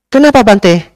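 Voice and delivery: high, loud pushy why; lady's question. Retold or imitated. Pushy lady demands to know why the monk refuses.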